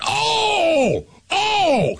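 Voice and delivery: very high mock crowd "oh"; crowd ooh. Pub crowd reacting to a comeback, high drawn-out ooh. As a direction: imitate the whole bar reacting to a burn. The whole place went.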